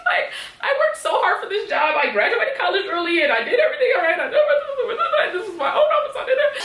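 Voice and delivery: high, laughing mock despair; hammed-up tearful protest over losing a job; pretend near-tears. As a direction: play it like you're about to cry, half laughing. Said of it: "I would've started crying".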